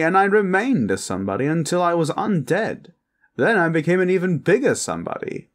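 boastful, high-pitched vampire aristocrat; posh vampire. Acted. Vampire boasting to partner of being a somebody, high and grand.

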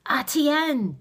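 high, loud impatient call. A narrator's reading. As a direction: snap out the name impatiently. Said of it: "he repeated impatiently".

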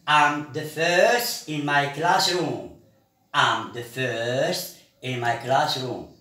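slow, careful classroom delivery; slow model sentence. Shown as a demonstration. Teacher modelling a sentence for the class, slow and clear. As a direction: say it slowly so pupils can follow.

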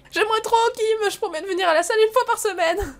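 high-pitched, keen promise; eager pleading. Acted. Player promising Kim to come to the gym, eager pleading.

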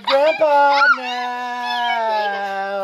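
high, slow, laughing protest; being tickled. Real, not acted. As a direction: laugh out the words while tickled. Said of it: "You're trying to tickle me".

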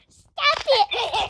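high-pitched put-on baby voice. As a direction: whine it like a little baby. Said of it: "Don't do that baby voice".

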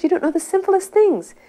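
high-pitched, insistent imitation of a rebuke; retold heavy scolding. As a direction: scold him as the guru. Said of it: "getting heavier and heavier with him".

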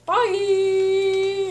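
cheery sign-off. Real, not acted. Sign-off to viewers.

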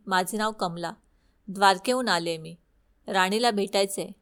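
usual speaking voice

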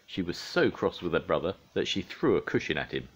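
ordinary delivery, nothing marked

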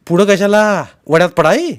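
high, loud questioning; shouted retort. A narrator's reading. Uncle refusing to step nearer the stream, shouting back. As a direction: throw the question back loudly.

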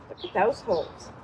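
ordinary talk

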